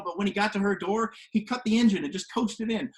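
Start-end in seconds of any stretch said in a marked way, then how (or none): none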